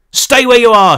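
loud, high barked order; police shout. A narrator's reading. Cop ordering the kids to stay put, loud shout. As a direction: freeze them in place. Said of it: "shouted the same man as before".

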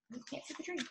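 soft aside. Real, not acted.

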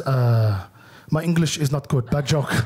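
put-on low, accented uncle backpedalling; imitating uncle. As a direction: uncle taking back his confession, low and accented. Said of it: "and he just goes".

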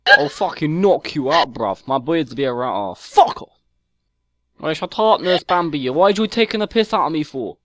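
exaggerated London chav accent, mouthy; whiny London chav accent, picking a fight; put-on London chav accent. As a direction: play a stroppy London chav kid on a game mic. Chav troll persona.

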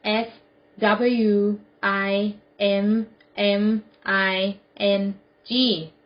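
letter by letter, slowly; spelled out. Shown as a demonstration. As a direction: spell it out with a pause after each letter. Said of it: "Spell it". (สะกดทีละตัวอักษรช้า ๆ)

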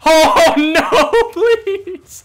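loud, high, drawn-out pleading; panicked wail. Real, not acted. Player being killed in the game, wailing in panic. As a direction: wail helplessly as doom closes in.

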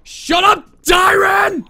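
high, very loud yell; angry shout. Real, not acted. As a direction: yell it hard at a teammate. Yelling at a player mid-game.

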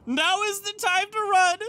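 laughing, then high alarmed; giddy panic, voice jumping up; excited panic. Real, not acted. Villain threat, player panics.

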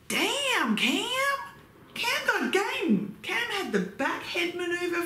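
high, drawn-out whoop of approval; impressed cheering. Real, not acted. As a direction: hype him up in a high voice. Cheering the kiss on the date.

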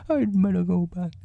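very high bear voice; high storybook bear in trouble; Pooh. A narrator's reading. Pooh stuck in the hole, high fretful voice.

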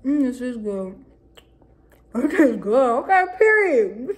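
eating and speaking; chewing while talking. Real, not acted. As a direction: mouth full of food. Seen 0: Bites chicken at -3s, cheeks full and jaw chewing while speaking.

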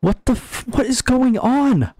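high, stunned and drawn out; can't believe what you see; shocked disbelief. Real, not acted. Replayed shocked reaction in game.

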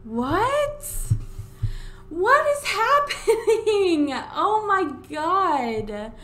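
high, slow, loud incredulity; incredulous, half-laughing wail; stunned disbelief. Real, not acted. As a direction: exclaim it in drawn-out shock. Stunned by sudden pet death.

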